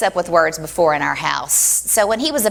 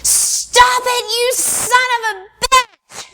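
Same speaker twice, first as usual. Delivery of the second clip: put-on high, slow screaming child; retold scream; much slower than usual. Little girl screams at her big brother Wally, high and slow. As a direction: shriek it out like a fed-up nine-year-old. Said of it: "I just screamed at the top of my lungs".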